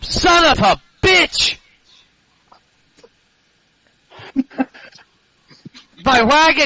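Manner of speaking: distant furious yell; shouting from another room. Acted. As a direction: bellow it from the next room. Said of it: "you can hear from the other room".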